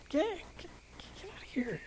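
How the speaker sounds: hushed, whispered dismissal; whispered shooing. Acted. Preacher shooing the devil away, whispered.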